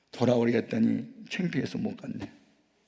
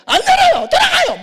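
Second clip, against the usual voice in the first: very high, screaming refusal; yelled refusal; 14 dB louder than usual. Retold or imitated. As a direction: scream the visitor away. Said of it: "막 고함을 지르면". (새된 고함으로 거부)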